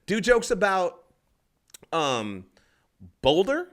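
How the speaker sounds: high-pitched imitation of a heckler; heckling lady. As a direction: call it out high, rising on the last word. Voicing the lady's shouted request.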